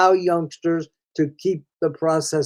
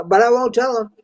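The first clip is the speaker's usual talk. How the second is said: mock protest, pitched higher; insistent protest; 6 semitones higher, 4 dB louder than usual. Father defending himself to his son's reading tutor, mock insistent. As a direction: imitate a parent digging in, raised pitch.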